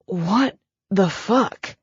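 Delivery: slow, strained, still short of air; hurt man repeating the curse, still winded; winded curse repeated. Acted. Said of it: "he said again".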